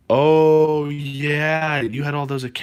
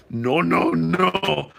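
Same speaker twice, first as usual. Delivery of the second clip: higher, drawn-out basketball legend impression; Dikembe Mutombo; 5 semitones higher, much slower than usual. Streamer imitates an NBA legend for viewers, drawn-out impression. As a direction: stretch it out in a put-on player's voice. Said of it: "I sounded more like Dikembe".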